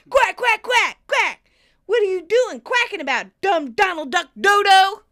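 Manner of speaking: high, loud mocking yell; rounding on someone. [name] rounding on the duck, hammed-up angry shouting. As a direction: jeer furiously, mimicking the quacks. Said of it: "[name] rounds on the duck".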